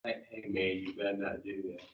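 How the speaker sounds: a quiet voice in your head cautioning you; little inner voice. Retold or imitated. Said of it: "do you hear a little voice say".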